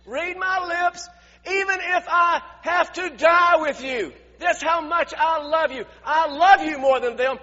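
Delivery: loud, high, emphatic pledge; insistent declaration. Acted. Peter insists he will die with the Lord, loud insistent pledge. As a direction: hammer out devotion at full volume. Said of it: "But Peter declared".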